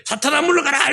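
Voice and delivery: high commanding cry at Satan; mock rebuke. Preacher mocking a showy rebuke of Satan. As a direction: bark the rebuke like a warrior.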